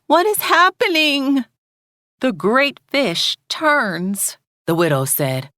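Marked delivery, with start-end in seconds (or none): 0.1-1.4 high, loud cry of alarm; a girl crying out in fright; startled cry as the ground shakes; frightened cry. A narrator's reading. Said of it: "Sanaya cried out". 2.2-4.4 put-on slow, higher, solemn old widow; a hint of a weary old widow; lightly voiced widow. A narrator's reading. The widow's calm answer.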